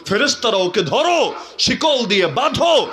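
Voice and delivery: forceful, high-pitched command; commanding divine voice. Acted. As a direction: order rising to a high shout. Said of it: "আল্লাহর পক্ষ থেকে আওয়াজ আসবে".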